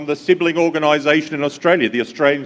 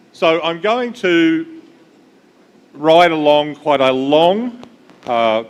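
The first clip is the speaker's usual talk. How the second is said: slow drawn out; 2 semitones higher, slower than usual. Shown as a demonstration. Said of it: "but very quickly".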